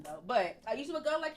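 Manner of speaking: ordinary delivery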